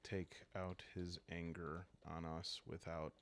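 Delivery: ordinary delivery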